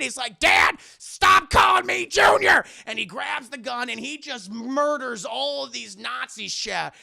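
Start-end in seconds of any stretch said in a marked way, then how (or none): 0.4-2.6 high, slow, full-voiced exasperated shout; exasperated yell. Son fed up with nickname, exasperated yell. Son's exasperated outburst acted.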